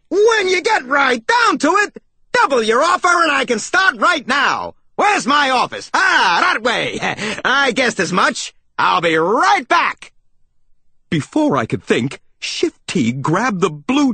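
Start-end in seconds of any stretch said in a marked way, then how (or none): 0.1-10.1 high, brash salesman holler; high-pitched, self-assured blare; bulldozing salesmouse. A narrator's reading. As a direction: steamroll the conversation in a big cartoon voice.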